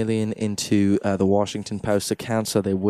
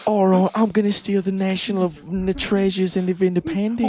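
higher Australian-flavoured hero line; mock Australian accent; 9 semitones higher than usual. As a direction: play the hero as an Australian bloke. Australian version of movie line.

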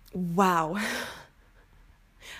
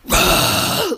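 sharp gasp; 9 semitones higher, 5 dB louder than usual. Retold or imitated. Shocked after hitting the cow.

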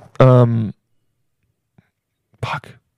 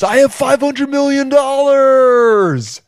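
high, drawn-out mock climax scream; long, high comic yell; climactic scream; 11 semitones higher, 3 dB louder, much slower than usual. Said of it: "just scream my net worth".

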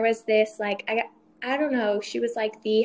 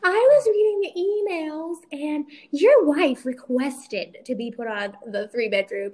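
imitating the housing agent; 4 semitones higher, 4 dB louder, slower than usual.

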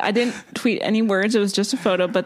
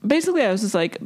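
normal talking voice

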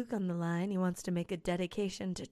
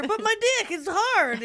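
mock whine; 12 semitones higher, 7 dB louder than usual. Voicing Luke whining.

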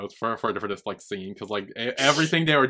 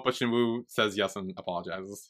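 usual speaking voice

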